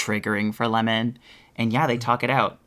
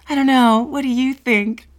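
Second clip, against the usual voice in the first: much higher, louder daffy voice; silly high talking-to-umbrella voice; 13 semitones higher, 7 dB louder than usual. Retold or imitated. Queen asking her umbrella and drink, high daffy voice. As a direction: ask an umbrella for advice like a ditzy queen. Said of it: "talking to the umbrella and her drink".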